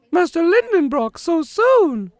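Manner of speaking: put-on high, far-off cry of a startled old maid; alarmed housekeeper. Martha startled by her master's early return, alarmed. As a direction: squeal it out in a panic. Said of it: "cried poor Martha in great alarm".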